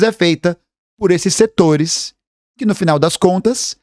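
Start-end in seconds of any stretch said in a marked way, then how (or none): none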